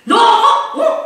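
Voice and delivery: put-on sharp, high angry yell at a child; angry shout. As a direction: bark at the boy in a mock fury. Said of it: "그의 방석을 제가 꽉 쳤어요".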